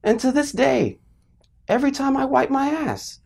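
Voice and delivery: high, wounded voice of lasting hurt; mock traumatized. Storyteller confessing lifelong Christmas damage, mock traumatized. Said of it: "I was traumatized".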